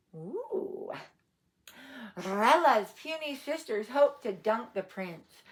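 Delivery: ordinary delivery, nothing marked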